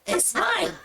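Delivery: put-on high, slow shrieking goblin girl. Goblin girl fighting the minotaur over the satchel, high shriek. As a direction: squeal it like a greedy little goblin. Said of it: "She's like".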